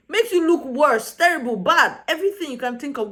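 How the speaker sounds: exasperated scolding. Real, not acted. Viewer scolding housemate for naming people, exasperated.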